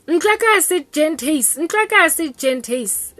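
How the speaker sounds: put-on high, slow drunk mother scolding; mother warns the boys: no girls here, slow and high; drunk mom scolding. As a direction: drag it out like a tipsy mom laying down the law. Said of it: "she kept being like".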